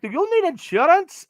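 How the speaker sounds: high put-on phone-caller voice; mock insurance caller, high-voiced; imitated telemarketer. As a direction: pitch it way up as a cold caller. Voices a telemarketer calling him.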